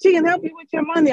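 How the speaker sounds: high, eager quoted husband. Retold or imitated. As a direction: pitch it up high and quick like the husband. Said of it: "telling them".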